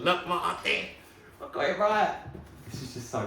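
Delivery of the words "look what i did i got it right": high, louder triumphant brag; proud excitement. Real, not acted. Telling viewers he kept everything, proudly excited. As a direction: crow about being right all along.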